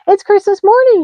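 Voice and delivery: high loud joyful cry; happy cry. A narrator's reading. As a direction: burst out with joy. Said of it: "he cried happily".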